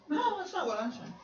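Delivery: high, mock-indignant reply to a critic; mock retort. Preacher answering an imagined critic, high and mock-indignant. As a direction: play the preacher brushing off a critic.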